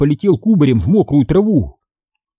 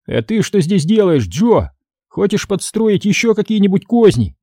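high-pitched accusing questions; 8 semitones higher than usual. A narrator's reading. Moose catches Joe at night, accusing. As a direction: demand to know what he's plotting.

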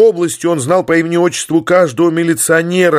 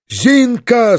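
yelling a name; 9 semitones higher than usual. Acted. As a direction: bellow «Зинка!» across the office. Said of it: "заорал он". (громко орёт «Зинка!»)

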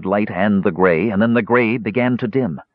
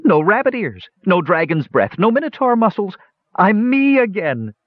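high, elated character voice; high-pitched rejoicing, close to tears; joyful cry; 11 semitones higher than usual. A narrator's reading. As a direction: exult in a high voice, overjoyed. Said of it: "he cried out joyfully".

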